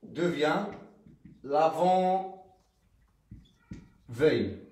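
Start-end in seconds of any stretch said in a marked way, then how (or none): 1.4-4.6 stretched out syllable by syllable, l'avant-veille; slow dictation. Shown as a demonstration. Teacher dictating l'avant-veille slowly to pupils. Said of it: "c'est attaché avec un trait d'union".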